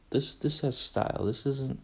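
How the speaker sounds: ordinary delivery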